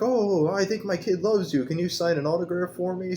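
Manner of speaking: squeaky mock fan; mock starstruck fan. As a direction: ham up an eager dad asking for an autograph. Mocking actor's fawning fan.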